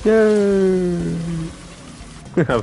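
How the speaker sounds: normal talking voice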